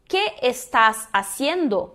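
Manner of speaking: slow, each S of "¿Qué estás haciendo?" a clear hiss; every S in "estás haciendo" fully sounded, words apart; strong Spanish S. Shown as a demonstration. Teacher showing students the strong S in Spanish, slow careful S sounds. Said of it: "o S sempre forte em espanhol".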